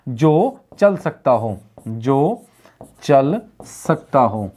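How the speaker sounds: very slow, word by word; slow dictation. Shown as a demonstration. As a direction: pause after जो, stretch चल and सकता. Teacher repeats meaning for dictation.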